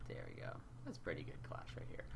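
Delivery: whispered under the breath; whispering. Real, not acted. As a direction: hush it to yourself. Hushed aside while adjusting cameras.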